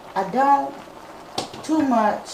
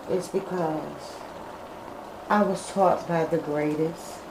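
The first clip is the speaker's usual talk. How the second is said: real grief, soft and low, halting with long pauses; heavy-hearted, subdued, holding back emotion; getting emotional; 3 semitones lower, 8 dB quieter than usual. Real, not acted. Cook remembering lost family to viewers, getting emotional. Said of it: "I felt myself getting emotional".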